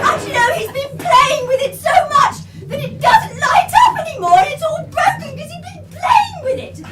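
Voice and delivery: ordinary delivery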